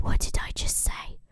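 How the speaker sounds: whispered What did I just say, no voice; exasperated whisper. Retold or imitated. Odysseus to his crew as the cowhides move, whispering. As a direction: hiss it through disbelief.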